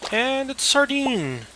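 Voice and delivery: high, slow delighted announcement; excited. Real, not acted. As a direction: draw it out in delight. Fish caught.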